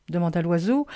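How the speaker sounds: ordinary delivery, nothing marked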